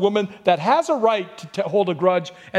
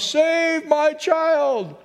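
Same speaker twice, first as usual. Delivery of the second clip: very slow, drawn-out cry, much higher; 9 semitones higher, much slower than usual. Retold or imitated. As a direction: stretch out a desperate plea for a child. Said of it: "crying out at the top of her lungs".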